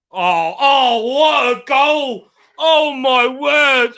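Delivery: high, loud, drawn-out awe; astonished shout. Real, not acted. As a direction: stretch out each word in stunned delight. Astonished by the goal.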